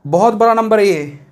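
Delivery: normal talking voice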